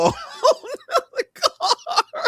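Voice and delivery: laughing. Retold or imitated.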